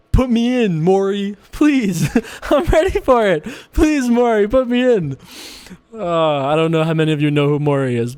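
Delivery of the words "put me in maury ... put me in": higher, louder eager begging; mock pleading. Begging producer Maury to put him on air, eager. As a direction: beg to be put in the game.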